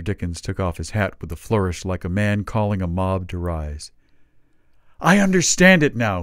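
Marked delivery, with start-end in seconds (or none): 5.0-6.2 loud, higher cry of realisation. A narrator's reading. Dickens cries to the company, rousing. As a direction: ring it out like a rallying call. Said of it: "he cried".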